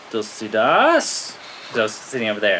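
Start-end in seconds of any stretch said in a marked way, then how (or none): 0.1-1.3 slow, drawn-out questioning call; teacher calling name. Retold or imitated. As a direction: stretch the name into a rising question. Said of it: "and he goes".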